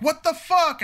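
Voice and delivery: high, stunned disbelief; a lady suddenly realising she's wrong; shocked. Retold or imitated. Lady staring at the father, stunned and high. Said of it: "gives this fucking look like".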